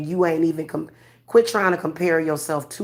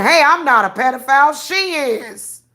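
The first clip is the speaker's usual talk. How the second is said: mock accuser; 6 semitones higher, 5 dB louder than usual. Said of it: "they pulling folk to the forefront".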